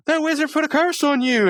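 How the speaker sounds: high-pitched mock accusation; retold alarm. Host retells Mordecai blaming the wizard's curse, high mock alarm. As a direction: imitate a panicked friend, pitch jumping up.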